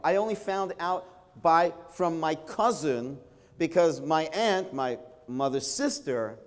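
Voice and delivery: normal talking voice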